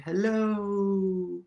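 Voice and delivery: ordinary talk, nothing marked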